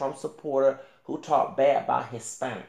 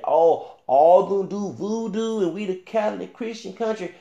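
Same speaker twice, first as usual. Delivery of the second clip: higher, louder mocking imitation; mocking quote; 5 semitones higher, 6 dB louder than usual. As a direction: mimic their complaint, raised and louder. Mimics the person criticized talking bad.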